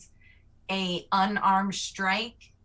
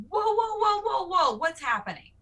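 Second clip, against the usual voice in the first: high startled whoa; higher pleading whoa; alarmed calming; 8 semitones higher than usual. Acted. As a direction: try to calm everyone down. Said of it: "And I'm gonna go".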